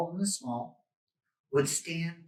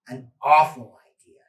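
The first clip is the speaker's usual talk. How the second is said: plain reading voice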